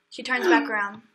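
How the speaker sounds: ordinary talk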